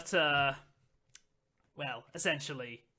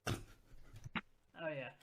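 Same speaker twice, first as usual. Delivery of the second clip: ordinary talk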